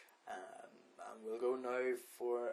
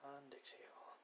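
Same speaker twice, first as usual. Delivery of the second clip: guided meditation; 14 dB quieter than usual. Acted. Podcast host guiding listeners through breathing, soft meditation voice. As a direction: lead the breathing gently with long pauses.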